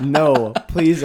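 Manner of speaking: loud, mock begging cry. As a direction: plead loudly in a hammed-up way. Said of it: "I begged".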